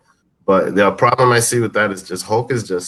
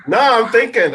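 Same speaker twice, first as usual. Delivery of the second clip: flustered protest; 11 semitones higher, 4 dB louder than usual. Real, not acted. Defending a long pause.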